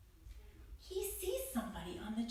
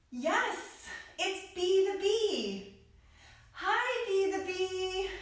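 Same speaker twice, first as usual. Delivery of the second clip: higher and louder, bubbling with delight; excited greeting; 8 semitones higher, 4 dB louder, slower than usual. Acted. Telling a child the story, greeting the bee excitedly. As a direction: cheer hello like a delighted child.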